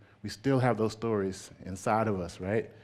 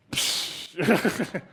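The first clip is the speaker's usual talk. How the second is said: high delighted laughter; real laugh; 8 semitones higher, 4 dB louder than usual. Real, not acted. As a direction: burst out laughing, pitched up. Laughing at the idea.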